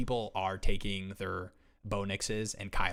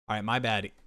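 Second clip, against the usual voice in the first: ordinary talk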